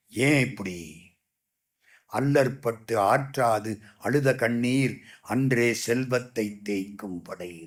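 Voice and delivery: lightly voiced elder, low and soft; a hint of a solemn old warrior; grave old Bhishma. Bhishma answers Duryodhana. (துரியோதனனுக்கு பீஷ்மர் சொல்லும் தாழ்ந்த மெல்லிய குரல்)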